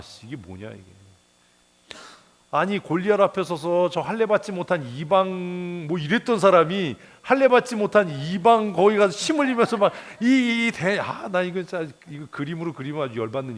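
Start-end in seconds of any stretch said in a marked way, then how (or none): 2.5-11.0 higher and louder, building outrage; heated exasperation. Real, not acted. Preacher ranting to congregation about David drooling, rising outrage. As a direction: rant in mounting disbelief.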